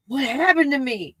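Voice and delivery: normal talking voice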